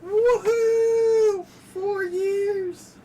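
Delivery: high, loud woohoo; excited cheer. Real, not acted. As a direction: cheer it like a party. Celebrating four years.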